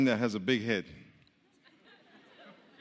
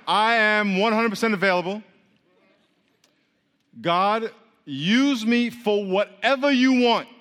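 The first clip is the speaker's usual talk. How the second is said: loud, raised-pitch declaration to God; bold declaration; 5 semitones higher, 5 dB louder, slower than usual. Shown as a demonstration. Telling God you are available, boldly declared. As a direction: build to a slow, emphatic plea.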